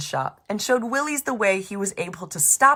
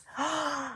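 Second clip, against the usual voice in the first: quiet dramatic gasp; soft gasp; 8 semitones higher, 9 dB quieter than usual. Acted. Narrator gasps softly before the fake ending. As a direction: catch the breath for effect.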